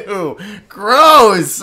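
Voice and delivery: laughing disgust. Real, not acted. Reacting to gross content.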